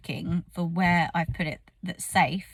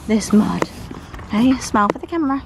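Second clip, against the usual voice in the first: talking to the horse; 2 semitones higher, 9 dB louder than usual. Real, not acted.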